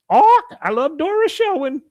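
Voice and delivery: very high, loud parrot squawk; piercingly high mock parrot voice; mynah bird imitation. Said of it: "I bought this mynah bird".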